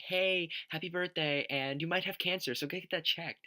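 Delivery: high-pitched imitation of mom on a call; imitated mom. Mom calling with birthday wishes and bad news, high imitated voice. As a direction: chirp it high, like a casual birthday greeting. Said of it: "my mom called me and was just like".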